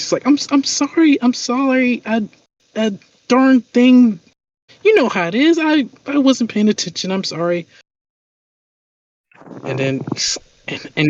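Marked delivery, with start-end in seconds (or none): 0.3-7.7 high, timid, apologetic stammer; a nervous man fumbling out sorries; meek male voice. Acted. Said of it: "a meek, like, male voice".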